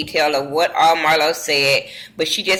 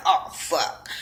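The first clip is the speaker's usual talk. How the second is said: hushed curse; 4 semitones lower, 7 dB quieter than usual. Retold or imitated. Sheree caught out.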